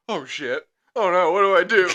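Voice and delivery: high, loud mock panic; retold panic. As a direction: play up a helpless panic, breaking into a laugh. Acts out his panic when hit.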